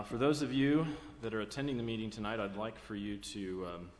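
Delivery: ordinary delivery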